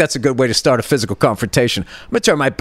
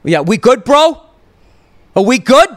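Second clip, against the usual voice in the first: imitated challenge, leaping high and loud on the questions; mock tough-guy dad squaring up; confrontational dad; 9 semitones higher, 6 dB louder than usual. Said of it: "he looks at me and he goes like".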